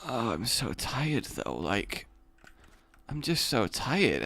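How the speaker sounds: slow, soft, worn-out groan; too tired to care, words dragged out softly; sleepy and drained. Acted. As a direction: mumble it slowly, half asleep. Acting out sleepy response to robbery.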